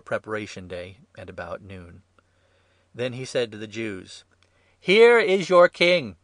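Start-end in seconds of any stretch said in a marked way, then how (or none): 4.8-6.2 slow, high, very loud announcement; public proclamation. A narrator's reading. Pilate presenting their king to the crowd, proclaiming loudly. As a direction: proclaim it in a drawn-out call.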